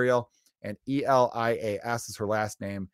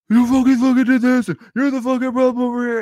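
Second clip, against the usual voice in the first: much higher and louder, a ranting scream; mock furious yelling; 13 semitones higher, 9 dB louder than usual. Podcaster mimics an angry political stranger, mock furious yelling. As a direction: blast it like someone losing it over politics.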